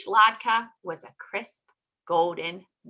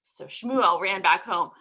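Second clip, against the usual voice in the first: plain reading voice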